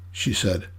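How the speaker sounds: usual speaking voice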